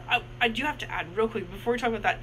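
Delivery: ordinary delivery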